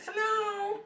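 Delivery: cat saying hello. Acted. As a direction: speak up for the kitten in a high greeting. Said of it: "He says".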